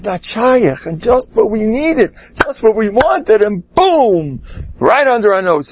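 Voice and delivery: high, mock wailing over the lost house; a hammed-up lament, pitched well up. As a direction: whine it up high like a heartbroken owner.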